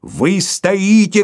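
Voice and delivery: high, loud, very slow shout. A narrator's reading. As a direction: drag it out as a roar. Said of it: "кричал Филипп Филиппович".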